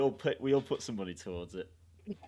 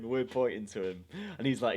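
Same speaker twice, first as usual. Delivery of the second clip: ordinary delivery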